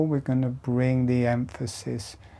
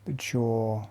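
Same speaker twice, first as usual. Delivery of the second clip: guided meditation; 4 dB quieter than usual. Acted. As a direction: lead them gently through each breath. Guides slow jaw breathing exercise.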